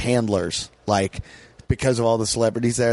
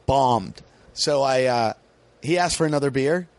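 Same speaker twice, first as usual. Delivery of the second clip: ordinary talk, nothing marked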